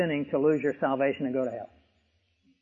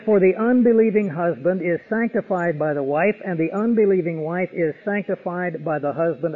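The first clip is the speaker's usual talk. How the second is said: louder, ringing pulpit voice quoting Paul; raised preacher voice reading scripture aloud; full-force preaching; 5 dB louder than usual. Acted. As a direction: proclaim the verse to the whole hall.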